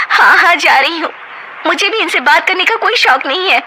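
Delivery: high, huffy and sarcastic; wife storming off to her room, sarcastic; sarcastic snap. Acted. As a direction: toss it over your shoulder as you leave. Nisha storms off.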